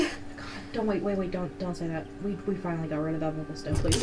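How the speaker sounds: quiet urgent pleading; hushed. Real, not acted. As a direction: hush them down quickly.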